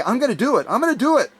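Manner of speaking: high, sing-song put-off promise; mock procrastinator. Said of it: "Don't put it off until the end and say".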